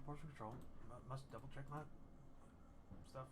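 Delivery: a distracted healer muttering to himself. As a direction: mumble it as a private aside.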